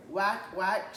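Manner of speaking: loud mock duck honks; duck quacks. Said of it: "she said".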